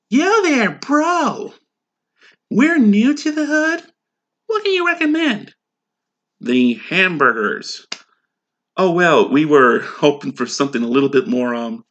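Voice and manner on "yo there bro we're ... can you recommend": higher, hammed-up street slang; mock street talk. New customers asking the waiter, hammed-up street talk. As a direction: play it as a goofy hip customer.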